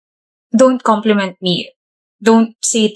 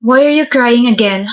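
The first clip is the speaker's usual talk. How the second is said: ordinary talk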